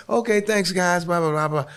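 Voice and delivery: mimicked sign-off. Comic wrapping up at the light, mimicked high sign-off.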